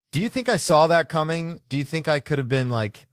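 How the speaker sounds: loud, high, defensive protest; indignant outburst. Real, not acted. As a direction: throw it back at the crowd. Comedian defends himself to crowd.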